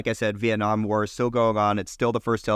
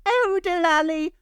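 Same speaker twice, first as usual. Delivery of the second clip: Oh, Delali in a hammed-up British accent, pitched way up. Asked by cohost to do British accent on air, hammy British. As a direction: sing out Oh, Delali like a cartoon Brit, high and stretched. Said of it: "do it in a British accent".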